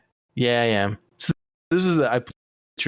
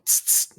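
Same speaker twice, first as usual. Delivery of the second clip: imitated spray bottle, two short hisses; sharp voiceless "tss, tss" squirts; water bottle squirts. Scolding a cat with a water bottle, imitated squirt sounds. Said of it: "with the, the water bottle".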